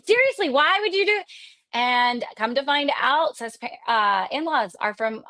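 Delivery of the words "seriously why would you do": much higher, baffled disbelief; can't fathom anyone doing that; incredulous. Retold or imitated. Mocking the move from California, high incredulous question.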